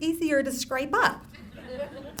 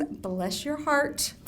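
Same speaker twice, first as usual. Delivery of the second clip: slow Southern drawl; 3 semitones higher, much slower than usual. Shown as a demonstration. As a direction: Bless your heart, drawn out slowly in a Southern lady way. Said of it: "mother of all Southern expressions".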